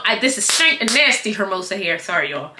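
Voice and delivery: ordinary delivery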